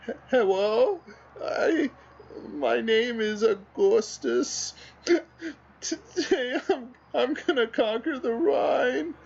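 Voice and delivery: very high, slow, weepy voice breaking into sobs; crying cat voice. Pretend. Teary-eyed cat meme narrating conquest, high sobbing voice. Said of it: "And it goes".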